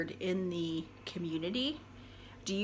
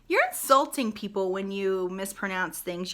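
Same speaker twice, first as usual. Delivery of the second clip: ordinary talk, nothing marked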